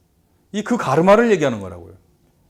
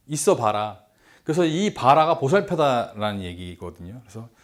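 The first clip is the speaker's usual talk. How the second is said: ordinary delivery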